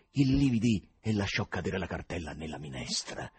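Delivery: plain reading voice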